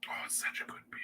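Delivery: hushed whisper of pleasure; a whispered aside about the beer. Real, not acted. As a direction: whisper it like savouring a sip. Savouring beer.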